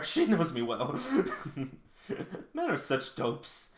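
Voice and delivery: words broken up by giggles; amused reading, chuckling between phrases; laughing. Real, not acted. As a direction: laugh through the line. Reader laughs through the character's lines.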